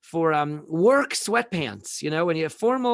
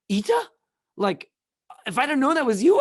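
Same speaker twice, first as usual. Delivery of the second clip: louder, higher squeal of surprise, the secretary realizing who it is; startled secretary; 8 semitones higher, 7 dB louder than usual. Retold or imitated. School secretary caught swearing at a colleague, shocked squeal. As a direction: gasp it out, caught red-handed.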